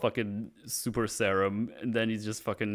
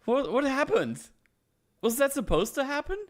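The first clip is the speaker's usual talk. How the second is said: surprised; 11 semitones higher than usual. Real, not acted. Boss fight breaks unexpectedly.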